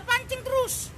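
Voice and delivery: pancing terus snapped high with strained voice; mock outrage. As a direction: spit out pancing terus, fed up. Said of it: "naik darahku". (pancing terus diucapkan tinggi dan kesal)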